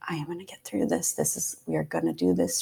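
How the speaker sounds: urgent hushed whisper to herself; whispered prayer. Retold or imitated. Mother in labor whispering a prayer to herself. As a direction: breathe the words out as a secret prayer. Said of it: "I remember just praying and being like".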